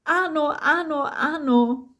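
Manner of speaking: high, emphatic repeated "ano"; hearty agreement. A narrator's reading. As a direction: pile up the yeses with gusto. Old friend booms on, reminiscing.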